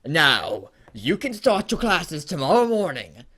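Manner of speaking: loud, high, commanding announcement; authoritative Dumbledore. A narrator's reading. Headmaster announcing classes to Harry, authoritative. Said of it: "announced authoritatively".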